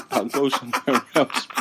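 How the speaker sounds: squeaky high laugh through the words; laughing so hard the voice jumps up. Retold or imitated. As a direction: giggle out the goodbye. Retelling leaving a churchy girlfriend.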